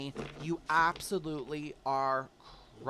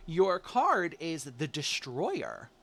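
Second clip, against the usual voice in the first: ordinary talk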